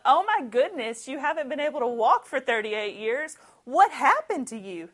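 imagined neighbour rejoicing over the healing, excited; joyful amazement. Retold or imitated. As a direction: gush over the miracle.

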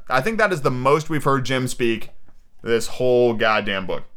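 ordinary delivery

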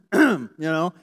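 loud meaningful you know; pointed hint. Retold or imitated. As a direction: say it loudly so the sister hears. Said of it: "Maybe she goes".

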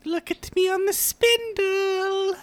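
high, fast squeaky disc voice; talking DVD voice. Acted. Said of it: "I'm Amelie".

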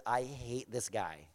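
low, very quiet, slow grumble; grudging confession. Retold or imitated. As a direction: confess it under your breath, dragging it out. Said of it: "he says".

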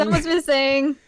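playful hammed-up indignation; mock exasperation. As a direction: chime in as the outraged bandmates. Co-host echoes the protest.

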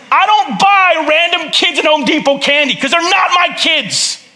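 normal talking voice

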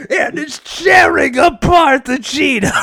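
high, loud, slow shouting; excited commentator shouting. Pretend. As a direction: bellow it like a fight commentator. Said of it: "the David Attenborough talks".

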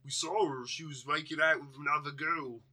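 hammed-up, deliberately shoddy British accent; bad British accent. Podcaster voicing creepy onlooker guys, bad British accent. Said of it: "doing a shitty British accent".

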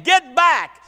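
loud, high, rhythmic preacher's cry; shouted sermon refrain. Retold or imitated. Preacher shouting the sermon to the church. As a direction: hammer the refrain home. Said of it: "we shouting the same sermon".

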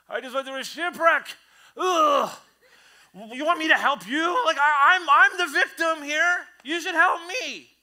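high, loud self-pitying whine; whiny victim. Retold or imitated. Shipwreck survivor refusing to help, loud whiny high victim. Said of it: "We can get into victim mode".

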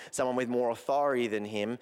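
ordinary talk, nothing marked

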